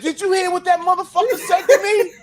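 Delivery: retold outrage. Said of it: "I said".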